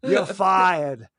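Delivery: hammed-up Maine accent, dropped r; crazy Maine accent. Foreman firing the dude, in a hopeless Maine accent. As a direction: try a bad Maine accent. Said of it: "It's the craziest Maine accent".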